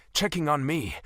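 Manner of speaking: usual speaking voice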